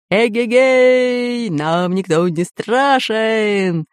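long drawn-out hurrah; exultant shout. A narrator's reading. Pirate Bul-Bul cheers from the flying nest, exultant shout. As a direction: stretch it out like a slow holler. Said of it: "кричал Буль-Буль".

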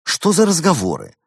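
high, loud indignant scolding; cross. A narrator's reading. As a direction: tell off a cheeky child. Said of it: "рассердилась Клара и топнула".

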